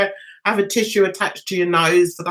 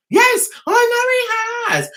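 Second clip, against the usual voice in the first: exasperated retort; 12 semitones higher than usual. Real, not acted.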